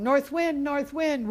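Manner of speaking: high, loud drawn-out call; yelling. Acted. Boy started calling to the North Wind, yelling. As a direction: holler the name into the wind. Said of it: "he started yelling".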